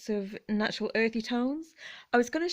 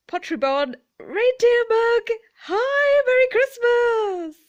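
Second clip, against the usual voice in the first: much higher and louder, gushing; excited squeal; 13 semitones higher, 10 dB louder, slower than usual. Real, not acted. Showing viewers a reindeer mug, squealing with excitement.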